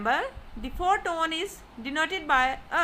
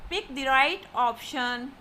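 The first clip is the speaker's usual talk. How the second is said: ordinary talk, nothing marked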